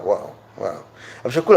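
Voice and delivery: usual speaking voice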